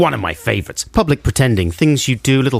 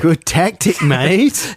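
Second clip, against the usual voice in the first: mock Aussie accent, pitched up; higher-pitched comic Aussie matey voice; Australian accent; 5 semitones higher, much slower than usual. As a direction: approve like a chirpy mock Australian. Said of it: "in the correct accent".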